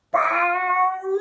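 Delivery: loud, high trumpet blast; long, rising elephant call. Acted. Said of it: "He sucked in the air and".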